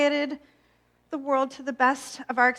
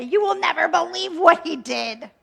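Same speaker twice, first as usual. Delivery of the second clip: higher, louder gossipy gush; mock gossip; 5 semitones higher, 5 dB louder than usual. Mocking herself hopping between friend groups, gushing gossip. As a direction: play the breathless gossip spreading news. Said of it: "conversation to conversation with the".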